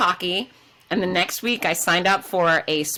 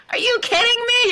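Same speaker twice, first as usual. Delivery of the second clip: high, louder cry of disbelief; incredulous outburst; 4 dB louder than usual. Retold or imitated. Retelling her reaction to the lockdown, a high loud outburst. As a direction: blurt it out, fed up and amazed.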